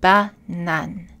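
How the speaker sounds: "Banane" in two even French syllables; French "Banane", same energy on each syllable; equal syllable stress. Shown as a demonstration. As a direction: say "Ba-nane" with identical a's. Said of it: "chaque A est identique".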